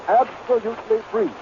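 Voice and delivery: ordinary talk, nothing marked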